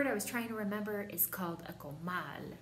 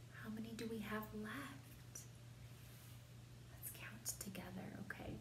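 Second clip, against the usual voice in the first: whisper; 3 semitones higher, 12 dB quieter, faster than usual. Acted. Whispering to children.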